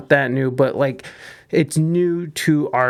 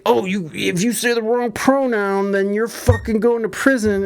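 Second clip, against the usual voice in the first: lightly mocking right-wing scaremonger, higher pitch; mock alarmist; 5 semitones higher than usual. Streamer mimicking the right's pronoun panic, mock alarmist. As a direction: imitate overblown outrage, pitched up. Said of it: "the right has sort of picked up this whole".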